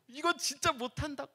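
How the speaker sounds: a mock child's frightened protest; imitating scared child. Frightened son on the rope course, imitated whine.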